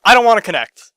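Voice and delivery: loud exasperated rant. Real, not acted. Ranting about Kinect as verb.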